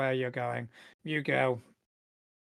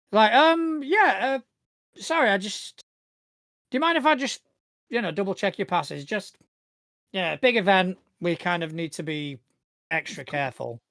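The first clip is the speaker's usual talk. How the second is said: higher-voiced, hesitant, apologetic guard; security guard; 5 semitones higher, 4 dB louder than usual. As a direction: stumble politely through asking for a check. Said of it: "security guard".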